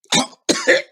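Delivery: sudden high hacking cough. Real, not acted. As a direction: cough up a swallowed bug. Just swallowed a bug.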